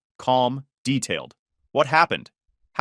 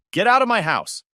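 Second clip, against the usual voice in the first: firm demand; 9 semitones higher, 4 dB louder than usual. Acted. Narrator orders brother out.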